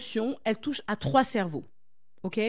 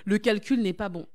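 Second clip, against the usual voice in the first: imitating audience; 4 semitones lower, 8 dB quieter than usual. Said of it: "vous allez me dire".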